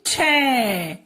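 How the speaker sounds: छै held long, gliding down; drawn-out syllable. Shown as a demonstration. Teacher answering her own question for the child, drawn-out छै. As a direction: stretch the vowel of छै. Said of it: "तो क्या बोलेंगे?".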